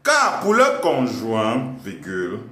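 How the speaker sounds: slow teacher dictation voice; dictating. Acted. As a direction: dictate it to the class. Dictating to class with punctuation.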